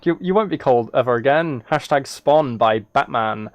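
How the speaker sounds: usual speaking voice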